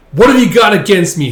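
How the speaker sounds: high, loud accusing yell; acted furious demand; angry shout. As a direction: explode into the accusation. Accusation repeated louder.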